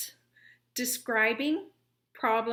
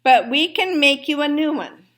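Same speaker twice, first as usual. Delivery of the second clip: announcement; 3 semitones higher, 7 dB louder than usual. A narrator's reading. Dentist announcing the verdict to the fox. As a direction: declare it clearly. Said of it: "Doctor DeSoto announced".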